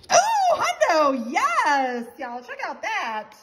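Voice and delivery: loud, high celebratory cry; real jubilant shout; thrilled win. Real, not acted. Wins hundred dollar bonus.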